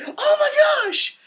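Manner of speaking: high, loud, drawn-out mock-horror shout; shocked exclamation. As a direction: shriek it slowly, reenacting your shock. Retells shocked reaction to the Scotsman.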